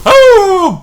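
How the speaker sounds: loud, high imitated dog howl; big high-pitched mock howl; howling back. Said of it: "my instant reaction is I just, I just go".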